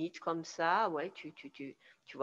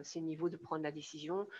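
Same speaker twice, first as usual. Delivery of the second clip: normal talking voice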